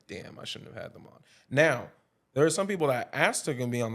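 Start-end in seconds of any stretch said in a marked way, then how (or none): 0.1-1.2 hushed inner voice; whispered thought. Retold or imitated. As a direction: breathe it like a private thought.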